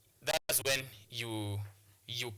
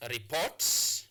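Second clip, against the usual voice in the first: "report" with the stress on PORT, louder at the end; stress on second syllable; 3 dB louder than usual. Shown as a demonstration. Teacher showing students second-syllable stress in "report". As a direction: lean on PORT in "report", voice rising. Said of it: "place your stress mark on the second syllable".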